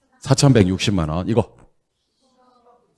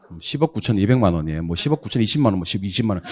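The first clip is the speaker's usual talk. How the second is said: ordinary talk, nothing marked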